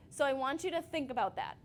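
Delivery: normal talking voice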